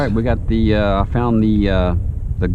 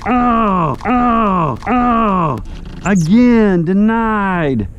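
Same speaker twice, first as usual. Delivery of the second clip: excited; 9 semitones higher, 5 dB louder, much slower than usual. Real, not acted. Fish lost at boat.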